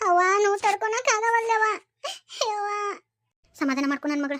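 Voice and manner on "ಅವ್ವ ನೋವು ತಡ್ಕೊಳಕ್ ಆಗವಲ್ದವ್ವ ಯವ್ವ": higher, crying out in pain; a woman in labour wailing for her mother. Acted. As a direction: cry out for mother through the pain.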